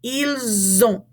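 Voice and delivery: "Ils ont" linked with a z sound; z liaison. Shown as a demonstration. Teacher modelling for repetition, "Ils ont" with z liaison. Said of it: "cause it to be a Z sound".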